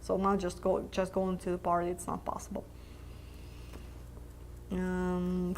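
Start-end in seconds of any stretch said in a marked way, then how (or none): none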